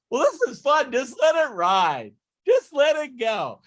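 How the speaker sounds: imitating happy-go-lucky excitement; mock carefree winner. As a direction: toss it off like a giddy gambler. The carefree winner keeps betting.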